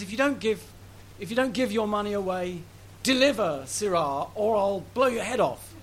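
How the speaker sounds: lightly voiced highwayman threat; hint of a robber's menace. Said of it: "he adopts the voice of a highwayman".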